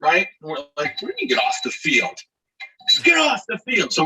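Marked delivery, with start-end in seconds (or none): 1.0-2.1 quick, high-pitched protest; fast, disbelieving comeback; indignant retort. Retold or imitated. Puzzled players answer the groundskeeper. 2.9-3.9 high-pitched insistent holler; mock groundskeeper's high command; imitated stern order.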